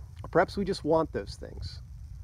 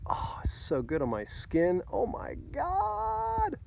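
sighing pleasure, stretching out into a high drawn-out finish; relishing, luxuriating exclamation; blissful savoring; 9 semitones higher, slower than usual. Retold or imitated. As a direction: bask in the heat, drawing out the last word. Reliving basking in copper-roof heat.

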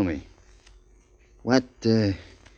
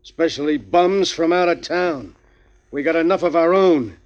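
higher, slow, hard-boiled cop laying down the law; a cop dismissing a bum with slow contempt; stern police captain; 9 semitones higher, 3 dB louder than usual. Acted. Police interrogating a vagrant.

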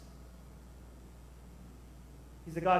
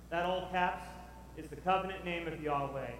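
usual speaking voice

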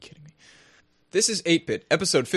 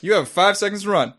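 usual speaking voice

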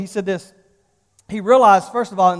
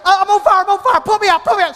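high, frantic mock cry for help; acted panic; 7 semitones higher, faster than usual. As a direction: plead urgently as if burning. Begs wife to put fire out.

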